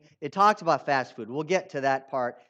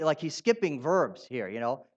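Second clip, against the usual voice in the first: normal talking voice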